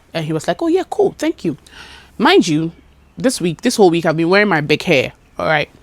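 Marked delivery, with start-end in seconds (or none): 0.6-1.6 casual guy. Retold or imitated. Customer quoted casually.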